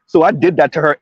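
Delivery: usual speaking voice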